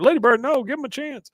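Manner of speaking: high-pitched mock plea after a runaway dog; pleading call. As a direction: call out anxiously, voice jumping up. Voicing Hank calling after his dog.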